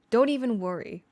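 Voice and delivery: ordinary delivery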